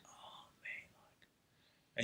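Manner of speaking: barely audible whisper; hushed disbelief. Retold or imitated. Soldier recalling news of his friend's death, hushed disbelief. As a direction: breathe it out, almost without voice.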